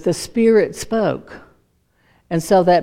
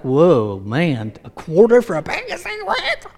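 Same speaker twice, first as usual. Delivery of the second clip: mock amazement. Imagined smoker amazed at a quarter a pack, mock astonishment. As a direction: marvel in a put-on squeal, breaking into laughter.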